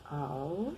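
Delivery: "Al" with L as U, like "au"; L sounding like U. Shown as a demonstration. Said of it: "Tem som de U". ("Al" com som de U)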